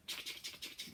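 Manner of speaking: soft unvoiced clicky chick, chick; whispered chick noise. Shown as a demonstration. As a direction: tick it out in a whisper. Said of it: "Make that chick, chick noise".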